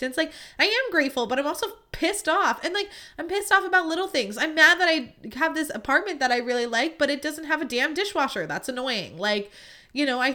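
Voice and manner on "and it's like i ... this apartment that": venting. Real, not acted. Venting rising frustration.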